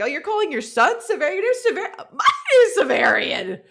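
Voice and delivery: high, hammed-up incredulous voice; mock disbelief. Hero hears the boy's name, high mock disbelief. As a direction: sputter in disbelief, high and quick.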